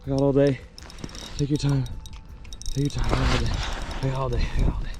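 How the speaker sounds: soft coaxing, as to a skittish animal; soothing. Real, not acted. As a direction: gently reassure the fish, fading toward a whisper. Soothing the hooked fish.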